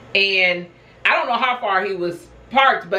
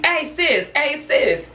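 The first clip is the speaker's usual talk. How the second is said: higher-pitched call, not raised in volume; calling from afar; 5 semitones higher, much slower than usual. Retold or imitated. Brother hailing his sister from down the street, mock distant call. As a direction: imitate someone calling out from a distance. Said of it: "he seemed like he's a distance away from me".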